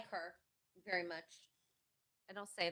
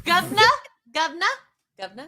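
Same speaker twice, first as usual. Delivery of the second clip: higher, questioning mock-Cockney; unsure Cockney attempt; 7 semitones higher, 5 dB louder, faster than usual. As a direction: try out the Cockney word uncertainly. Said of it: "I don't know how to do Cockney".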